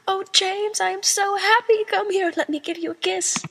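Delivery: high, mock-swooning gush; gushing delight. Sirius gushes high at James over his secret plans. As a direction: coo it like a lovestruck sweetheart.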